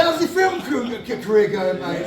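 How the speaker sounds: high, loud mocking dig through laughter; gloating laugh. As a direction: rub it in, laughing. Taunting the ex-runner at counter.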